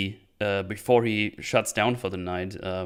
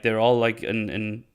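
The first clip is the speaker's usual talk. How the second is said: ordinary talk, nothing marked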